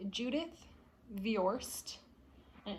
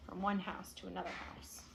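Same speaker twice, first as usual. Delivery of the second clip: soft aside; 5 semitones lower, 10 dB quieter than usual. Real, not acted. Aside to listeners.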